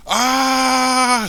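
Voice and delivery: sustained high, loud scream; pained scream. A narrator's reading. Michael hears the voice in his mind and screams. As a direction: let out a long cry of agony. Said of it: "screams Michael".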